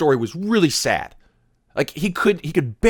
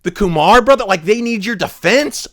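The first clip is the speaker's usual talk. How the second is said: normal talking voice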